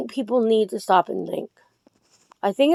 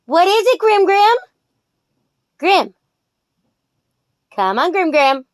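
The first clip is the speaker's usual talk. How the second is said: high, sing-song coaxing to a pet rooster; higher coaxing voice for her chicken; doting pet talk; 10 semitones higher, 4 dB louder than usual. Real, not acted. Calling her rooster Grim.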